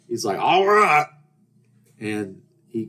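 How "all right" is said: loud, high Southern holler; Southern accent. Retold or imitated. Stranger accepts the invitation, hollered Southern accent. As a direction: answer in a broad Southern accent. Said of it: "in a Southern accent".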